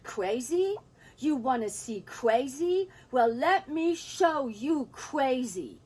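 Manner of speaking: rising, slow threat of fury; furious retort. Retold or imitated. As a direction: throw the word crazy back at him, then slow and menacing. Mother furious at husband.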